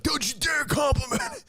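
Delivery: high, shouted mock outrage; hammed-up furious shout. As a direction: shriek it like an offended rock star.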